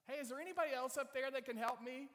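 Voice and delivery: high, loud call; yelling up. Acted. Man on the cliff seeking other help, yelling up. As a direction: holler upward for anyone else. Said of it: "he yells up".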